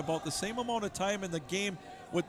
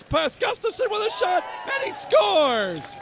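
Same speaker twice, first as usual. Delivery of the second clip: building to a shouted goal call; excited goal call; 12 semitones higher, 7 dB louder than usual. Real, not acted. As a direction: yell it as the puck goes in.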